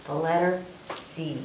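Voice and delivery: ordinary delivery, nothing marked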